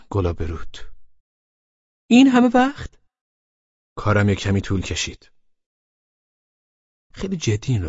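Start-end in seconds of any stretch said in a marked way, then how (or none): 2.1-3.0 put-on high, loud girlfriend voice; pitched up as the annoyed girl; girl's voice. A narrator's reading. Laleh scolding him for being late, high voice.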